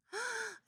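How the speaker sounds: sudden high gasp of recognition; a quick excited intake of breath; sharp gasp. Real, not acted. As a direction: gasp in delight.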